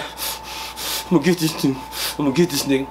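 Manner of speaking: slow, winded mock threat; imitating the winded man muttering threats; mock tough talk. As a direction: drag the threat out like a man out of breath. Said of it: "And he was like".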